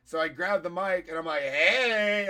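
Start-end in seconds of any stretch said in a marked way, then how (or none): 1.4-2.3 long, loud, high hey; calling to audience. Retold or imitated. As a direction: hail a room from the mic. Acting out greeting the audience.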